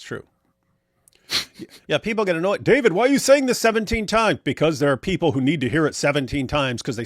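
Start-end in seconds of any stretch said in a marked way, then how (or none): 2.6-4.4 high, loud whiny complaint. Retold or imitated. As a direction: whine like an annoyed viewer. Mimicking annoyed viewers.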